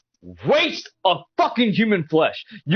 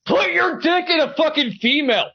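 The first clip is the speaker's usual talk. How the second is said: higher-pitched shouted outburst; angry shout; 5 semitones higher than usual. Real, not acted. Man insulting his opponent mid-argument, angry shout.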